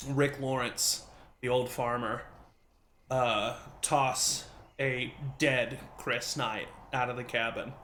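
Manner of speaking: ordinary delivery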